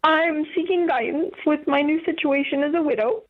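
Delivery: real tears pushing the voice high; emotional, tearful. Real, not acted. Widow asking radio host for guidance, tearfully emotional. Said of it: "sorry, I get so emotional".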